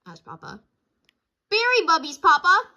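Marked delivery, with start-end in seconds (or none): 1.5-2.7 put-on high, loud eager child voice; excited cub. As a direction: insist eagerly. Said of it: "said brother".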